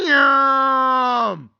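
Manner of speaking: high, held yell of agony; long frustrated howl. Retold or imitated. Bettor reliving the wasted drive, anguished howl. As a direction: let out one long falling wail.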